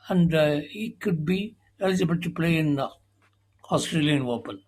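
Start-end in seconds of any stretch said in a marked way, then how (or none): none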